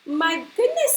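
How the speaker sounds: put-on surprised old lady. Angela finding the baby bird, astonished. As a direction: gasp it out like a startled granny. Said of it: "said Angela".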